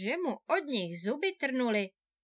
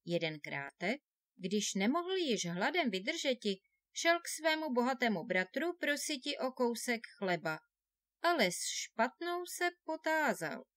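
ordinary delivery, nothing marked

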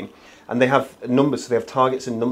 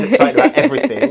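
laughing; 12 semitones higher, 6 dB louder, faster than usual. Real, not acted. Teasing colleague's excitable habit.

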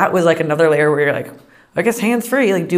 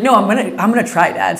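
louder, higher, playful defiance; louder teen protest; 3 semitones higher, 6 dB louder than usual. Retold or imitated. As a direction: push back at dad, half laughing. Defiant teen to her dad.

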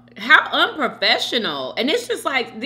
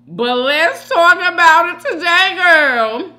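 hyped up; 9 semitones higher, 11 dB louder, slower than usual. Real, not acted. Hyped to start the topic.

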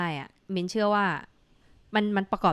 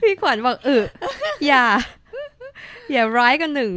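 louder, higher excited reading; 8 semitones higher, 8 dB louder than usual. Retold or imitated. Host reads a listener comment aloud, loud and excited. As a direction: call it out like a teasing friend.

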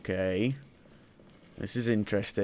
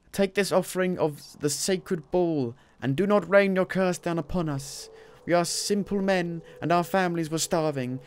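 ancient speak; 6 semitones higher than usual. Pretend. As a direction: pray like an ancient supplicant. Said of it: "doing it in that kind of voice".

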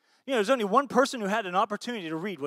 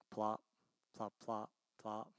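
lower, very soft plops; soft plop sounds; 9 semitones lower, 15 dB quieter, slower than usual. Retold or imitated. Pastor imitating rocks falling, soft plops. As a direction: mimic stones dropping softly.

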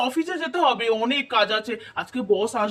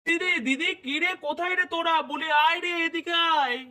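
higher-pitched call on arrival; 5 semitones higher, 3 dB quieter than usual. Acted. Brother arrives calling for his sisters, calling out. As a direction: call for your sisters to come over.

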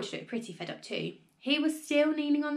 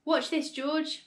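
higher-pitched, gleeful call; lightly raised, eager voice; excited shout; 7 semitones higher, slower than usual. A narrator's reading. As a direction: invite the boy to watch the show. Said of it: "Mister Cranky shouted".

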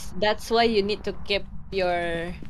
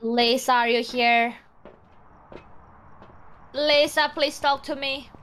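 louder, raised call to a ghost; 2 semitones higher, 4 dB louder than usual. Pretend. Player summoning the ghost Lisa, calling out. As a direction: call into the house for an answer.

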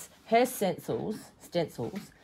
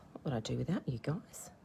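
whispering; 6 semitones lower, 11 dB quieter than usual. Real, not acted. Whispered aside.

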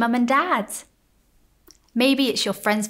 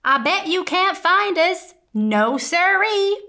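teasing shout; 9 semitones higher, 7 dB louder than usual. Acted. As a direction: tease the sheriff from hiding. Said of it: "he shouted".

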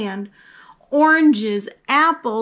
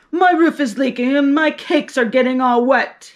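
louder moaning baker voice; moaned; 2 semitones higher, 6 dB louder than usual. Acted. Baker moaning about his leaking roof, louder whine. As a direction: complain like a put-upon baker. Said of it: "he moaned".

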